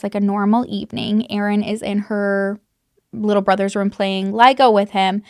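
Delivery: ordinary delivery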